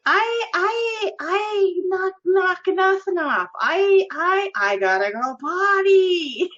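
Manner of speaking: put-on high, slow, stammering childish whine; husband's loud put-on voice. Wife imitating husband embarrassing her in public, loud childish voice. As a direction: imitate an overgrown child announcing it, drawn out. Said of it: "He's shouting it really loud".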